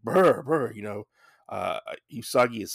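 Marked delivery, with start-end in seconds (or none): 0.0-0.7 shivering brr. Retold or imitated. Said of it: "Usagi's like".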